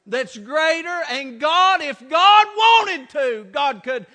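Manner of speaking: slowing, swelling to a loud shout; climactic preaching. Real, not acted. Preacher assuring congregation God is bigger, climactic shout. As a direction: build steadily to a shouted peak on change.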